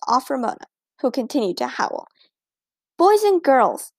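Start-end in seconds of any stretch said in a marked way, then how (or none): 3.0-3.9 higher, louder call to the class; teacher calling the room to order; teacher raising her voice over noise; raised voice. A narrator's reading. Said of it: "Miss Robbins raised her voice".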